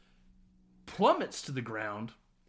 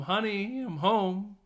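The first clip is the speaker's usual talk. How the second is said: higher, lilting mock call; a lightly voiced lonely woman; sing-song homecoming; 7 semitones higher, 3 dB louder, slower than usual. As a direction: call it out to an empty flat. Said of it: "her coming home".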